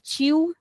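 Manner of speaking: chiu as chee-oo; ch variant. Shown as a demonstration. Teacher lists allowed names, chiu with ch. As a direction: chiu starting with ch, no L. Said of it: "You can call it".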